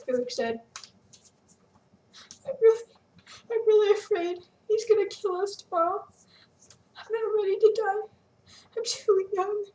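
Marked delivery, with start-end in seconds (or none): 2.5-9.7 higher, frightened plea; crying in fear. A narrator's reading. Sarah confessing her fear to Felix, higher and frightened. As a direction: tremble with dread. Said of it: "she cried".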